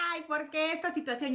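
high, soft pretend whine; mock crying. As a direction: imitate yourself wailing in self-pity. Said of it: "Llorando, ¿saben?". (imitando un llanto lastimero)

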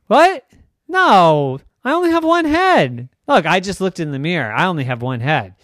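high, loud shocked protest; can't believe what he hears; alarmed kid. Acted.

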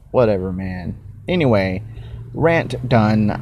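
usual speaking voice

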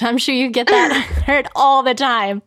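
ordinary talk, nothing marked

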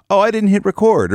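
high-pitched pretend alarm; mock panicked realization. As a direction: act out a sudden oh-no moment. Imagined dismayed discovery of mistake.

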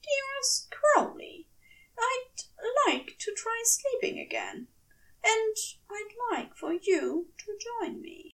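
formal angel invitation. Acted. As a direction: ask with courtly care.

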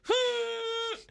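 high, sustained hoot; imitated stage sound. Podcaster imitating sounds from the stage, held high hoot. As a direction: imitate a long high flute note. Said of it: "sounds like that".

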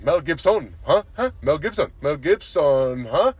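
hammed-up coworker impression, last name drawn out; imitated Mexican coworker. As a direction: holler the name gleefully, then chase it with huh. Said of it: "and he goes".

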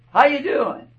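bright, high welcome at the church door; cheery greeting. Shown as a demonstration. Pastor greeting a churchgoer, cheery and high. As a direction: greet them like you're glad to see them. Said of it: "Have time to make sure".

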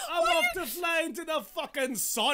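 high, hammed-up Scottish declaration; Scottish accent. Exiting as Icarus for laughs, high Scottish accent. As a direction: sound like a Scottish comic in full cry. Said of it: "doing a Billy Connolly".

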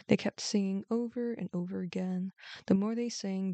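usual speaking voice

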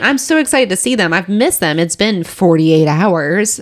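usual speaking voice

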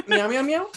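high meow imitation; nervous cat voice. Imitating the meme meows.

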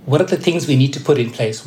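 ordinary talk, nothing marked

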